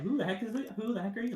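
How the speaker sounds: imitating a confused nurse; surprised nurse. Nurses not recognizing shaved patient.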